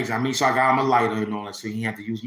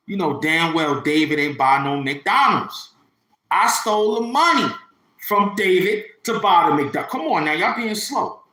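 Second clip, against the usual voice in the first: much higher and louder, building outrage; exasperated rant; 7 semitones higher, 6 dB louder than usual. Real, not acted. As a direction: yell the truth at the audience.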